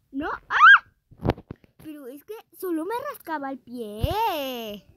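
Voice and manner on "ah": startled scream. Real, not acted. Sudden fright in game.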